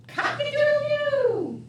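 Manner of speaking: high crowing rooster call. Acted. Reading to a child, crowing like the rooster. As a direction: crow it out like a rooster.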